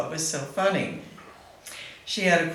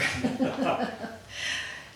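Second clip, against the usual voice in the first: soft laugh; 9 semitones higher, 3 dB quieter than usual. Real, not acted. Laughing about grandbabies.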